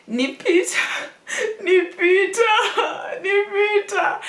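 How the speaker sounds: high, hammed-up wail; mock crying. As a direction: sob out the name, half laughing. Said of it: "that's how I was crying".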